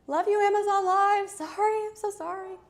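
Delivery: high, loud gushing apology; playful apology. Real, not acted. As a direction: gush an over-the-top sorry.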